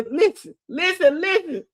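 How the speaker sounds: high-pitched, repeated demand; mock insistent plea. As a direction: imitate him pleading for the floor. Acted demand for attention amid laughter.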